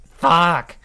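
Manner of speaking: loud cry of pain; pained yell. Acted. Pain under torture.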